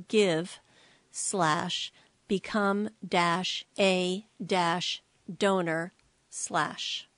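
each word spelled out slowly; slow dictation. Real, not acted. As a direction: read the web address slowly for listeners to copy. Spelling out a web address.

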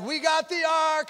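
high, drawn-out celebratory cheer; jubilant worship. Acted. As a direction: cry it out like a rejoicing crowd. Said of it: "they're all worshiping".

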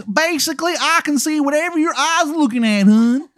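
high-pitched, hammed-up Baltimore wizard; terrible Maryland accent. Wizard explains his spying to Adam, terrible Maryland accent. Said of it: "it is a terrible Maryland accent".

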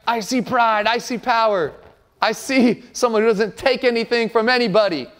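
usual speaking voice

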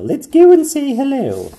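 excited little princess; Pearl's voice. A narrator's reading. Pearl pointing out the palace, excited. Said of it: "said Princess Pearl".

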